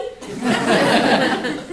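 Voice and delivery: loud, bursting real laugh. Real, not acted. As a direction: crack up at the coincidence. Laughing at the coincidence.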